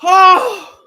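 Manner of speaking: high, loud goal celebration; ecstatic shouting. Real, not acted. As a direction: scream it with pure joy.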